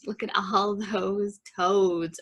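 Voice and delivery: ordinary delivery, nothing marked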